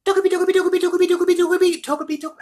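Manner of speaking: squeaky-high rapid tongue twister. Shown as a demonstration. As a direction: rattle it off way up high. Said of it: "do it in a higher pitched voice".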